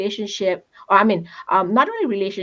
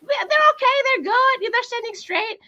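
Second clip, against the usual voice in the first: much higher, singsong dismissal; mock breezy. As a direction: brush it off in a chirpy voice. Mocking a naive assumption.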